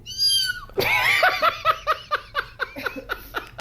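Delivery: squealing laugh; high-pitched laugh. Real, not acted. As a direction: shriek with laughter. Laughing at cat sneeze.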